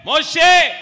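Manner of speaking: loud, high call across the mountain; God calling Moses on the mountain, loud. Acted.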